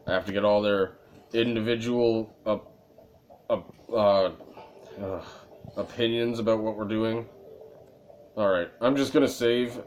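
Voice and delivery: ordinary talk, nothing marked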